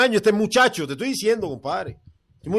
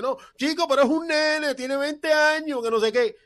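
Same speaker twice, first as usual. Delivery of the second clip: high, softer mock whine; quejica imitado; 6 semitones higher, 3 dB quieter than usual. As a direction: mimic the co-host's whining excuse, pitched up. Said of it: "Es la misma lloradera". (lloriqueo burlón, en voz aguda)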